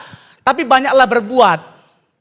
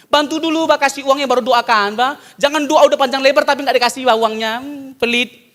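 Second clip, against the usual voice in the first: ordinary talk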